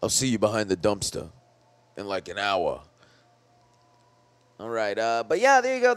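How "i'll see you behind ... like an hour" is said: low movie tough-guy voice; low, put-on action-star come-on; Stallone impression. Movie star propositions the woman who hit him, low impression. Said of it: "What if he was like".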